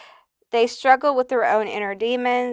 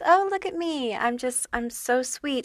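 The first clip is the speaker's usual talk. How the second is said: higher mock-cute voice; sweet innocent act; 4 semitones higher, 4 dB quieter than usual. Reader mimicking the hater's harmless mask, mock-sweet. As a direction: play cutesy and harmless, pitched up. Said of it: "very cute".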